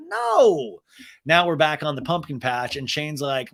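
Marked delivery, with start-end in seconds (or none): 0.1-0.8 high, loud, falling "no"; emphatic mock refusal. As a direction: overplay a quoted dad's refusal. Acted dad rejecting his own question.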